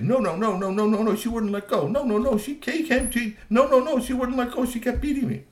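high, panicky stream of denials; frantic protest. Acted. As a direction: scramble to deny it, squeaky. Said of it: "Grassy was trying to say".

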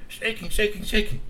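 rising high excited shaking. A narrator's reading. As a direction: pitch up on each shaking. Branch shaking again.

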